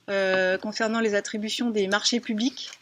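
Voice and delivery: ordinary talk, nothing marked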